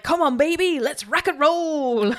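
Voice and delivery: high swaggering greaser voice; greaser tough guy. Retold or imitated. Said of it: "He's like".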